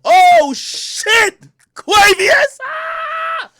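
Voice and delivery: high fanboy squeal into a long scream; excited fan. Retold or imitated. Host acting out seeing [name] walk up, excited screaming. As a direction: shriek the greeting, overjoyed. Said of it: "So I'm excited".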